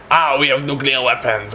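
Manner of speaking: put-on French accent announcement. The French revealing their weapons, French accent. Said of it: "And the French are going to".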